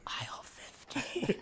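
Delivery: slow, drawn-out creepy whisper. Pretend. Creepy caller reveals where they lurk, slow whisper. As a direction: whisper it slowly like a lurking caller. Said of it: "That would be the creepiest call ever".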